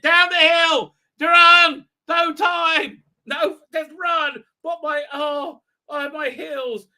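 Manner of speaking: hammed-up camp panic; panicked yelling. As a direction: holler them down the hill. Thals yelling while running.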